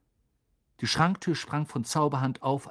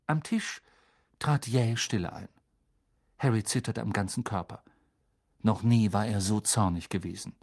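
ordinary delivery, nothing marked

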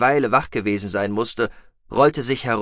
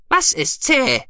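very high, loud creature voice; curious mousebeaver; 5 dB louder, slower than usual. A narrator's reading. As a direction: ask it in a high piping voice. Guki's question in acted voice. (hohe, laute Mausbiberstimme, fragend)

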